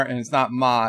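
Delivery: normal talking voice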